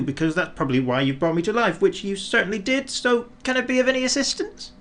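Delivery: high, fast rambling snowman; chatty snowman. A narrator's reading. Talkative snowman offering Father Christmas help, rambling. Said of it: "rather talkative sort of a snowman".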